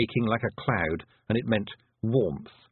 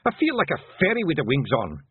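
higher pixie voice, a touch fed up; weary Hamish; 6 semitones higher, 3 dB louder than usual. A narrator's reading. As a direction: grumble after a bad landing.

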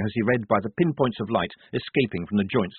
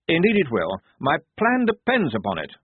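proud; 7 semitones higher, 4 dB louder than usual. A narrator's reading. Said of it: "said Blouse proudly".

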